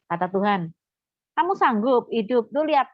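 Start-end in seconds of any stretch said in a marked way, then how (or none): 1.3-2.9 higher-pitched, pointed question; retold challenge. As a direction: challenge them as God would, retelling it. God's challenge to patient, retold. (menantang dengan nada tinggi)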